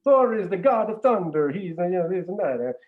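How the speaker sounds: showing off Thor in a hammed-up Swedish Chef accent. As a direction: boom it out like the Muppet Swedish Chef. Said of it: "This is a very racist accent you're doing right now".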